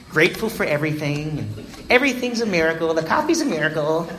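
sing-song mockery of cheerful members; mock-chirpy miracle talk. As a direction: parody their gushing gratitude. Mocking cheerful AA people.

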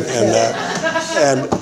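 usual speaking voice

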